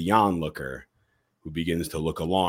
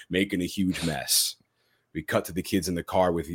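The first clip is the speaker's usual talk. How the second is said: ordinary delivery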